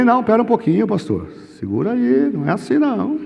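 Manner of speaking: acted alarmed "wait a minute"; startled backpedalling. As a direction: try to hold the pastor off after a fright. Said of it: "Aí me deu um, um susto".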